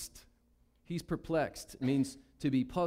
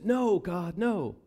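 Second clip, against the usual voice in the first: raised, higher protest to God; Peter protesting; 5 semitones higher, 5 dB louder, much slower than usual. Acted. Peter refusing God in the vision, loud protest. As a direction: push back hard, pitch up and louder.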